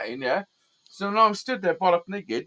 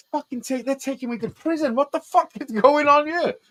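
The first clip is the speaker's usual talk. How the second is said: retold disbelief; 8 semitones higher than usual. Retold disbelief, driven to prison.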